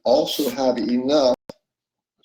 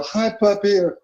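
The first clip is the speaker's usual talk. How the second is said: higher, tender coo; baby talk to a puppy; 8 semitones higher than usual. Retold or imitated. Greeting a puppy or loved one, high tender baby talk. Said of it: "You see, your voice changes".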